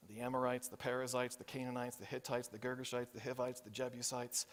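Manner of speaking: soft, low roll call; hushed list of nations; quiet litany. Real, not acted. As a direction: murmur the names one by one.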